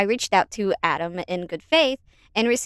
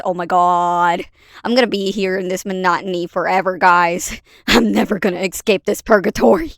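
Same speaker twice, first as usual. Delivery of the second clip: mock despair; 8 dB louder than usual. Loud mock despair at the script.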